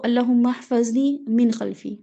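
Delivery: slow, drawn-out Arabic dua; prayer recitation. Shown as a demonstration. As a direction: recite each word slowly and deliberately. (دعا آہستہ آہستہ پڑھتے ہوئے)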